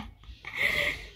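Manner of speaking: high, breaking laugh through tears; a crying laugh that cracks upward; teary laugh. Real, not acted. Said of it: "naiiyak ako".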